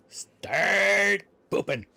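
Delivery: pleading. Pretend. Begging critters to produce.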